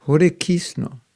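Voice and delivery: a Bengali-style Hare Kisno. Shown as a demonstration. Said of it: "If you go to Bengal".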